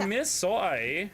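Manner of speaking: high, loud hammed-up Canadian accent; mock Canadian accent. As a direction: tease in a broad Canadian accent.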